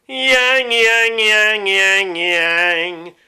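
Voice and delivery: loud, high witchy yang. Shown as a demonstration. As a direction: forward witchy drill. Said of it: "Try it a, a pitch higher".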